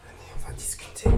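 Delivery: hushed invitation; library whisper. Acted. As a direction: whisper to the hidden children. Invite les esprits à l'abri. (chuchotement complice)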